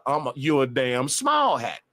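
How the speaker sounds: normal talking voice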